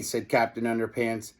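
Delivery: plain reading voice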